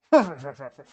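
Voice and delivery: short barking cackle; barking laugh. Acted. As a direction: bark out a laugh. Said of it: "it's like a bark almost".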